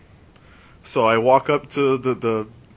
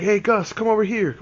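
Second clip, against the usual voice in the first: pitched-up friendly call to Gus; calling over a buddy; 9 semitones higher than usual. Retold or imitated. Older guy at a tailgate calls Gus over, upbeat. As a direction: hail him like an older party guy.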